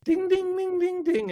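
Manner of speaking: high sung ding ding like a bell; ice cream machine chime. Retold or imitated. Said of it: "So it parks up".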